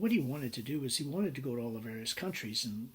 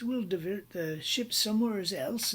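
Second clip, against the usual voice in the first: lightly voiced Australian accent, higher pitch; a hint of mock-Australian, pitched up; Australian fellow; 7 semitones higher than usual. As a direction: imitate an Aussie businessman offering a fix. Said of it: "the Australian fellow".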